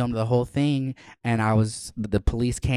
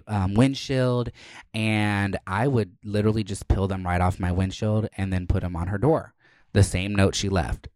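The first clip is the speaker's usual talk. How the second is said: ordinary talk, nothing marked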